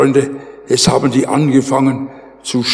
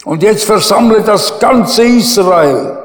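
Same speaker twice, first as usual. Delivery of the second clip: higher and louder prophet's rebuke; forceful rebuke; 5 semitones higher, 4 dB louder than usual. Retold or imitated. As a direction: turn the accusation right back on the king. Prophet answers the king forcefully.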